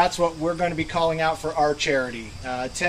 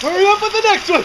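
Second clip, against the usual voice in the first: shouting; 13 semitones higher, 5 dB louder, much faster than usual. Real, not acted. Ice water being dumped on him.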